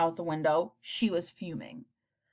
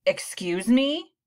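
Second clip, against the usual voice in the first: slow, louder indignant retort; shot back; 4 semitones higher, 5 dB louder, slower than usual. A narrator's reading. Mother retorting to the principal, indignant. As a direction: bristle and fire it back. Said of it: "she shot back at him".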